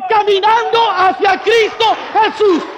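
normal talking voice

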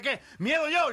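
very high, loud indignant yell; furious offended cry; outraged shout. Acted. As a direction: bristle at being called a coward. Soldier outraged.

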